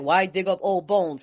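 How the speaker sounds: lightly mocking their excuse; mock whiny skeptic. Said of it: "I love how people be like".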